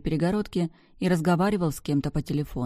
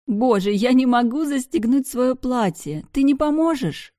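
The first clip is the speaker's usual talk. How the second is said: high, loud giggly plea; tipsy excited call; 9 semitones higher, 6 dB louder than usual. Acted. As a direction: squeal it out, tipsy and helpless. Drunk girl laughing, calling out.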